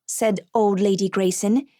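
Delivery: ordinary delivery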